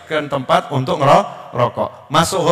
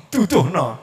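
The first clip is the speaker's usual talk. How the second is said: ordinary talk, nothing marked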